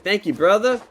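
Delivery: a bright, high, loud thank-you; mock cheerful tow guy. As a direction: imitate him suddenly all friendly. Tow guy cheerful once paid.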